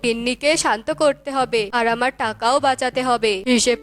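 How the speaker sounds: low, anxious husband muttering; worried husband voice. Acted. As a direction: fret about the angry wife. Husband Tona worried aside.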